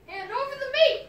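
pirate yelling for meat at dinner, loud and high; greedy pirate yell. Acted. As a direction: bark it out mid-gulp. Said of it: "gulped his food and yelled".

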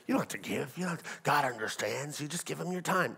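a hint of put-on reassurance. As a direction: imitate a pious excuse-maker. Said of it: "I heard Christians say that".

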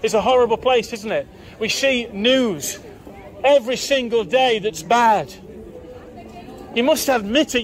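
ordinary talk

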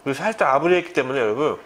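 savoring a bite; talking while chewing. Real, not acted. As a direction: mouth partly full. Seen 2: Sushi bitten at -7; mouth still working food while speaking.

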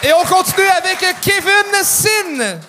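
announcer call. Real, not acted. Host bringing the next comedian on, high announcer call. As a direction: call it out high, stretching the name.

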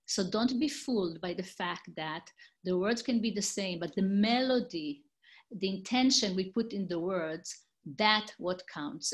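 normal talking voice

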